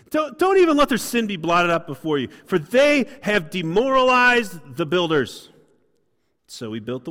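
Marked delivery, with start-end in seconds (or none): 0.1-5.5 louder indignant plea; torqued prayer. Acted. Nehemiah asking God not to forgive, loud indignant plea. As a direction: demand God withhold forgiveness. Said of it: "Nehemiah's torqued".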